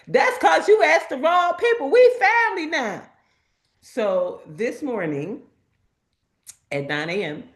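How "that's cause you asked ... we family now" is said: high, raised holler; imitated background scream. Husband calling out behind his wife on the phone, imitated holler. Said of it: "her husband screams in the background".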